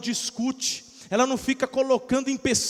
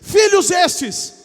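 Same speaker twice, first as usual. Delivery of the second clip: much louder and higher, shouting the sermon; fiery preaching; 7 semitones higher, 10 dB louder than usual. Real, not acted. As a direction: preach at full force to the crowd. Preaching at full force. (pregação inflamada aos gritos)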